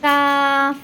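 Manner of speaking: কাফ thin and light, not heavy; কাফ crisp and forward; light Arabic kaf. Shown as a demonstration. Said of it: "এই কাফটা একটু পাতলা করে পড়তে হয়".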